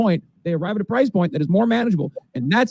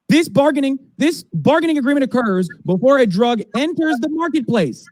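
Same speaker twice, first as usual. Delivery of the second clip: passionate rant; 8 semitones higher than usual. Real, not acted. Ranting about drug prices.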